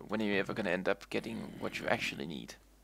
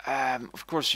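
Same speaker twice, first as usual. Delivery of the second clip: said out loud and higher; louder; 4 semitones higher, 7 dB louder, slower than usual. Shown as a demonstration. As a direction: push it out louder. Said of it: "I'm repeating myself".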